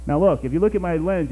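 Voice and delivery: ordinary delivery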